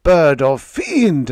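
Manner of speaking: high, loud shriek; a loud, high-pitched shriek. Acted. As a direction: cast the fiend out at full voice. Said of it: "I shrieked".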